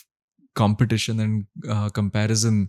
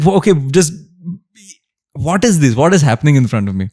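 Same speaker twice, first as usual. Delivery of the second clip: bartender losing his mind; 5 semitones higher, 7 dB louder, faster than usual. Retold or imitated. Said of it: "And he's like".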